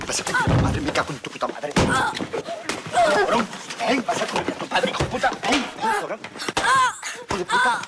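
loud, very high cursing; father raging at his son; enraged screaming. Acted. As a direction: scream the insults in fury. Father rages after being spat at.

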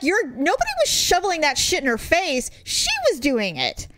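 mildly outraged retelling; indignant. Retold or imitated. Retold indignant reply about lawsuit.

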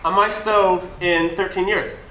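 slow, pointed boast; slow deliberate. Acted. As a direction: say it slowly for effect.

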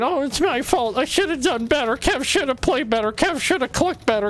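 imitating a sulky teammate crying it's all his fault; mock whiny self-pity. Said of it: "usually I'd be crying a little bit saying".

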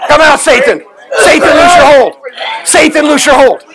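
shouted exorcism commands; deliverance shouting. Acted. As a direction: order the demon out at full force. Casting out a demon.

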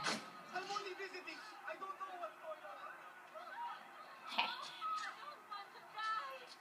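drawn-out, high giggling; long high laugh. Real, not acted. Gamer after smacking a character, long high laugh.

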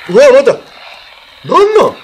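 loud, high alarmed shouts; startled shout. Real, not acted. Storyteller startled by a sudden noise, loud shocked yelps. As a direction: yelp in real shock. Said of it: "reacting to the speed box".

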